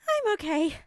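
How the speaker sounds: high, loud, awkward reassurance. A narrator's reading. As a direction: brush it off a little awkwardly. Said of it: "Uraraka laughed awkwardly".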